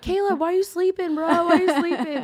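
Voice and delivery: loud, high-pitched mock questioning; hammed-up, incredulous ribbing; teasing callout. Josh teasing Caleb, retold.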